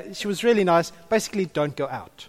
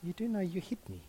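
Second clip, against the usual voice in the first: calm quiet remark; 15 dB quieter than usual. Retold or imitated. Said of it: "Taryn looks at me and says".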